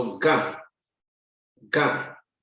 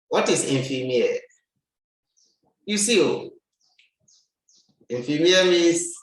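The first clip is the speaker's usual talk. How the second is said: usual speaking voice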